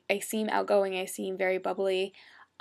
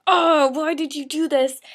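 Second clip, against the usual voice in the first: high, loud, drawn-out mock wail; exasperated lament; 6 semitones higher, 10 dB louder, slower than usual. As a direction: wail slowly at a character who let you down. Retold fan reaction to hero's wrongs.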